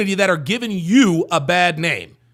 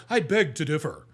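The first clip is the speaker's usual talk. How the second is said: lower, softer mock-proper voice; fake proper talk; 6 semitones lower, 6 dB quieter than usual. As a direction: imitate an affectedly correct speaker. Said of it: "fake ass proper talking ass".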